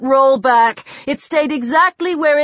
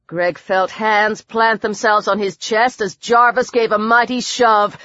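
ordinary delivery

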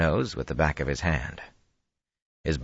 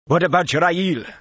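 much higher, loud shout from hiding; calling out; 14 semitones higher, 6 dB louder than usual. Acted. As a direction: yell the question from the trees. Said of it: "another called".

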